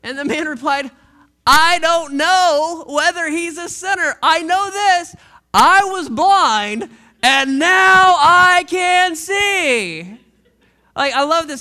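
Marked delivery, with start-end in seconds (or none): 1.4-5.2 louder, slow and defiant; bold declaration. Acted. Healed man defies leaders. 5.5-10.2 louder, very slow and drawn out; triumphant proclamation. Acted. Healed man's climactic testimony.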